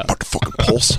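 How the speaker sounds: unvoiced mock whisper; whispered quote. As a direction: hiss it under breath. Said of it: "he goes".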